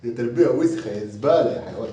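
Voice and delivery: ordinary talk, nothing marked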